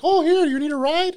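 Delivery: high, loud caricature of a woman offering a lift; mock offer of a ride. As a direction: squeal it like an eager stranger. Voicing the unwanted woman offering.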